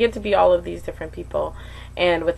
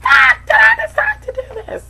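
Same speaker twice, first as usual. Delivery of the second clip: much higher shouted wail, half laughing, half crying; frustrated shout; 11 dB louder than usual. Real, not acted. Screaming at herself for starting the challenge. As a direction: scream it out in despair. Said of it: "I'm so frustrated".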